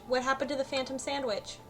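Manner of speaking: usual speaking voice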